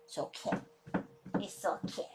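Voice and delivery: whispered reassurance. Real, not acted. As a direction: whisper it's okay to calm him. Soothing the dog.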